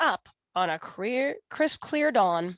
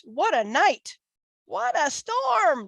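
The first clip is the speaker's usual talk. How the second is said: high twittering bird voice; a little bird chirping excitedly; twittering wren; 6 semitones higher than usual. Acted. Wren arriving at the den, high twitter. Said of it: "twitters wren".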